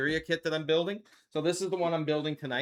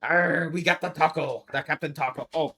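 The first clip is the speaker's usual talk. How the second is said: ordinary talk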